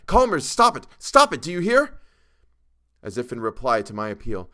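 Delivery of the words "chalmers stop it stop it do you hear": high, loud, desperate command; urgent cry. A narrator's reading. Narrator begging a crazed friend to stop, crying out urgently. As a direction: cry out to stop someone losing control. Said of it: "I cried".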